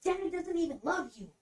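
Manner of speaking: high-pitched mocking imitation; retold taunt. Jenna taunting her about Daddy's love, mocking. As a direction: imitate a cutting jab, pitch way up.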